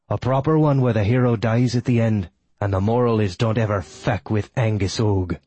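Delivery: taunting enemy in a character voice; villain's taunt, voiced apart from the narration; sneering villain, mocking. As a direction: gloat over a foe with building spite.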